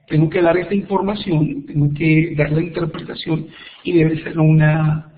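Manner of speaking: ordinary talk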